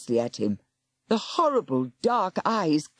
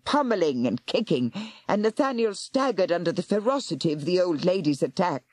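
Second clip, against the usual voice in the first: plain reading voice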